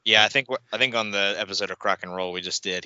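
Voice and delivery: ordinary delivery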